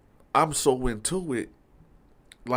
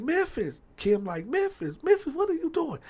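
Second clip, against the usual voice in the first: retold cry of alarm, pitched way up; imitated alarm. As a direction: imitate an alarmed woman calling him off. Retelling Kim's alarmed plea to Memphis.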